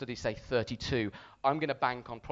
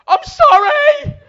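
high, drawn-out panicked scream; screamed apology; 20 dB louder, much slower than usual. Retold or imitated. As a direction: scream it slowly over a spinning ride. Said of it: "just scream".

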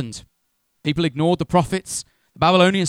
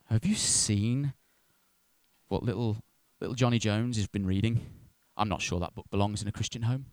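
low, hushed disapproval; acted church gossip; 6 semitones lower, 6 dB quieter than usual. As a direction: confide it softly, like passing on a scandal. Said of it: "No, we'll go".

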